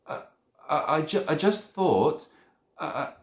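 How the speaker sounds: high, halting stutter. A narrator's reading. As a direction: stumble over the words nervously. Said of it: "I stuttered".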